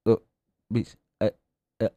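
words cut off mid-syllable; bad connection. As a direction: fake a breaking phone line. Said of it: "ini suaranya putus-putus banget".

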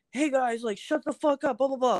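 lightly mocked bar crowd, pitched high; imitated biker regulars. Biker regulars defending a crying comic, lightly imitated high voice. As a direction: raise the pitch to mimic rowdy regulars. Imitates hecklers shutting each other up.

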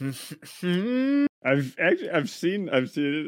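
excited. Real, not acted. Excited laughing outburst.

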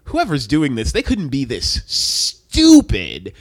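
low voice, incredulous scorn; low and contemptuous, drawing out the final words; scornful disbelief. Retold or imitated. Acting Light's scornful disbelief.

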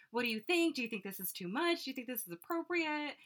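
lightly mocked outfit worry; imitating anxious questions. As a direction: mimic a friend fretting for approval. Voicing friend anxiously asking about outfits.

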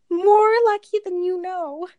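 stagey reply, lightly voiced; quoted show line. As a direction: say it like a stage reveal. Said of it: "reply line from the show".

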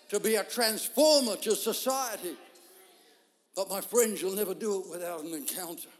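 loud, building sermon cadence; fervent preacher's call; preaching. Acted. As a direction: preach it out to the congregation. Preaching at full force.

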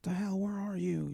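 put-on high, drunken where-are-you; drunk yelling. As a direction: whine it high like a drunk boyfriend. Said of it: "You're like".

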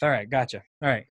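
normal talking voice

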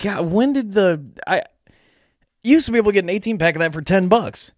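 high-pitched griping at the price; exasperated disbelief. Retold or imitated. Drinker griping that his beer's price shot up, exasperated. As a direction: pitch it up, baffled and put out. Complains about tripled beer price.

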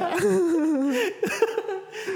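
high-pitched burst of laughter; loud laugh. Real, not acted. Joking about a mock breakup. (tawa lepas bernada tinggi)

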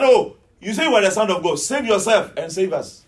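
loud taunt from a criminal; jeering challenge. Acted. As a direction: throw it at him loudly.

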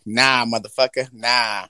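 ordinary delivery

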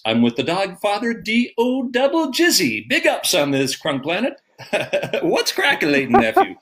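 high, laughing mock hip-hop greeting; hammed-up rapper voice. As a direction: deliver it like a nervous broadcaster trying to sound street. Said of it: "it came out like this".

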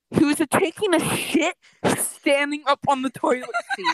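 high, loud, hammed-up gossip voice; scandalized retelling. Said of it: "And he's like".

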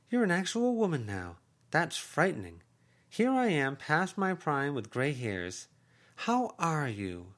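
voiced aunt, high and urging; effusive aunt. Aunt talking to niece.

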